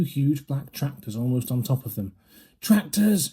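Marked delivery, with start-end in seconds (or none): 2.6-3.3 high sharp alarm call; shouted. A narrator's reading. Mister Fox warning his family, high alarmed shout. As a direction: cry the warning out. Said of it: "shouted Mister Fox".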